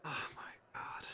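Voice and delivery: slow stunned whisper. Real, not acted. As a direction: breathe it out in disbelief. Reacts to negative four roll.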